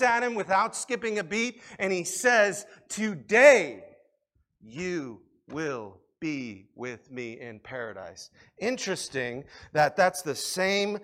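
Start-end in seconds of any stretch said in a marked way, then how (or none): 2.9-3.8 a loud, ringing "Today" that falls; emphatic proclamation. Acted. Jesus answers the thief. 4.6-8.3 lower, softer and slow; tender promise. Acted. Jesus answers the thief.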